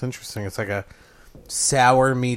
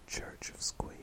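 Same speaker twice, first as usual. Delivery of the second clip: whispered slogan; whispering; 16 dB quieter, slower than usual. Pretend. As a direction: breathe the slogan. Whispered ad tag.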